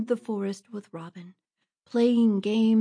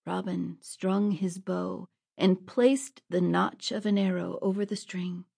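ordinary talk

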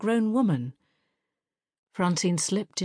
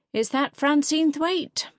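high, slow, carrying jibe; loud and pointed; 11 semitones higher, slower than usual. Acted. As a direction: make the whole room hear. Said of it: "loud enough for everyone".

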